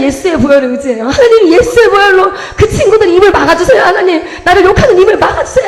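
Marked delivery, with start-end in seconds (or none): 1.1-5.7 high, loud pleading prayer, half laughing half crying; desperate laughing-crying prayer. Retold or imitated. Schoolgirl begging God to silence the bullies, laughing through tears.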